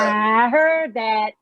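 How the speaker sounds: loud, slow call from another room; called from upstairs. Acted. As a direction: shout it down the stairs. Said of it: "[name]'s voice can be heard from upstairs saying".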